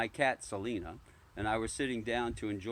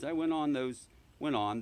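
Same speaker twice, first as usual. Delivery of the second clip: ordinary delivery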